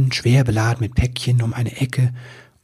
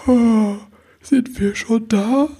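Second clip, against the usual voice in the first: high, slow, sleepy voice; yawning; 10 semitones higher, slower than usual. A narrator's reading. Elf woman wakes on the sleigh, yawning sleepily. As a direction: stretch it out through a waking yawn. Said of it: "Da gähnte sie".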